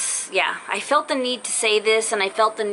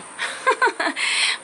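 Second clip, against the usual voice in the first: high breathy giggle; 14 semitones higher than usual. Real, not acted. Joking she can come along.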